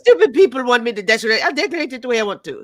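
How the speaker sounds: mock Melania going off in accent; Melania impression. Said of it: "You did it. ... I can't do that accent at all".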